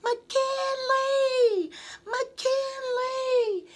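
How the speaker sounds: very high shouted name; yelling. A narrator's reading. Little sister yelling up the stairs at brother. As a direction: yell his name up the stairs. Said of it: "Kendall shot up the stairs yelling".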